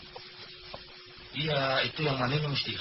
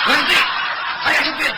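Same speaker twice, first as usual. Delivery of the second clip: high, loud shout to break up a fight; driver's shout; 10 semitones higher, 6 dB louder than usual. Acted. Driver yells at the quarrelling passengers to stop, loud and high. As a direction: yell them into silence.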